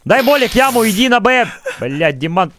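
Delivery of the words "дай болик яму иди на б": громкий высокий приказ; командует в бою; выкрик команды. Real, not acted. (выкрик)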